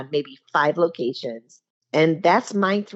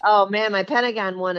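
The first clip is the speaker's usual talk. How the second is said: ordinary delivery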